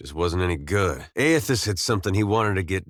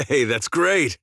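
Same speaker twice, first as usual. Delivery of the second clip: amused chuckle; 6 semitones higher than usual. Acted. Companion enjoying country talk, amused chuckle. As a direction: chuckle into a drawn-out, higher reply. Chuckles at good news in game.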